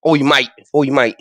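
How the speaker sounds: lightly voiced Cockney tough guy; mock Cockney threat, half-hearted; Cockney accent. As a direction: play a London heavy picking a fight. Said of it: "That's a bit cockney".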